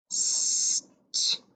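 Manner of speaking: St-ung whispered, split into St and ung; whispered sounding-out. Shown as a demonstration. Teacher whispering St-ung to spelling students. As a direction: whisper St-ung in two parts.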